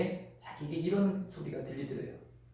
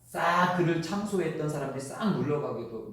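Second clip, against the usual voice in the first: ordinary delivery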